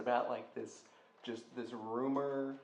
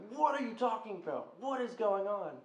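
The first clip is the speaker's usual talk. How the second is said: fed up; 8 semitones higher, 3 dB louder than usual. Retold or imitated. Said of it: "And I got so fed up".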